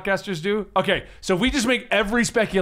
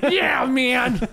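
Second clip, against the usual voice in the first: high fanboy voice breaking into laughter; a laughing online bro; internet voice; 5 semitones higher than usual. Pretend. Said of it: "Your internet voice is weird".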